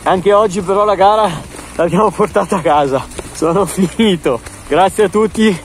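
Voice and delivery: shivering from the cold rain; teeth chattering. Real, not acted. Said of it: "battendo i denti perché si congela".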